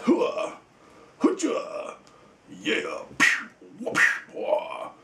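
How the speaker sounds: breathy martial-arts yelps and kick noises; karate kick cries. Pretend. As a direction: fire off short mock kung fu shouts and whooshes. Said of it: "he can do his karate kick".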